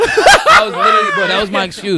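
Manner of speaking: bursting into loud high laughter; laughing. Real, not acted. Recalling his own dumb excuse, laughing loud and high. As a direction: laugh hard at how dumb it was.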